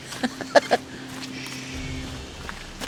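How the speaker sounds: squeaky wheezing laugh; laughing hard; high breathy laughter. Real, not acted. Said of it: "[name]'s creasing up".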